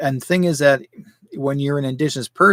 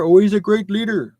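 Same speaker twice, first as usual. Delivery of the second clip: higher, louder, mocking the claim; sarcastic mock praise; 7 semitones higher, 6 dB louder than usual. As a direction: sneer it like fake praise. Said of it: "You know, sure".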